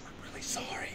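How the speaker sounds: hushed whispered apology; whispering. Acted. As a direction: whisper it, leaning in. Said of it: "I gotta whisper that part".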